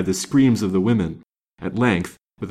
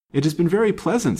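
impulsive, higher-pitched remark; 9 semitones higher, faster than usual. A narrator's reading. Young woman to her companion after the outing, impulsive. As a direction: blurt it pleasantly, pitched up. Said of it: "said Ada impulsively".

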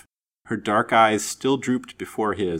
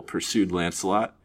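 ordinary delivery, nothing marked

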